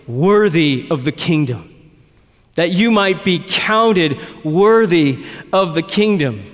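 slow, solemn proclamation of worth; God the Father's call. Retold or imitated. Preacher voicing God the Father slowly to afflicted believers. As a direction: hand down a solemn verdict from heaven. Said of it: "God the Father shouting".

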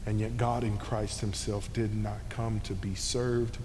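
normal talking voice

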